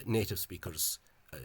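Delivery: normal talking voice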